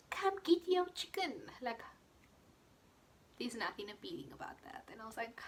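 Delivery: ordinary talk, nothing marked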